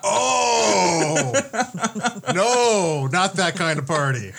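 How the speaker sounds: high laughing yelp; laughing shout. Real, not acted. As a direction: burst out laughing, loud. Realizes it's a brothel.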